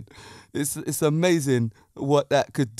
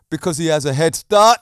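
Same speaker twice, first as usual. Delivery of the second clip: punchline burst out loud through laughter; can't hold the laugh in; 2 semitones higher, 6 dB louder than usual. Real, not acted. Punchline while cracking up.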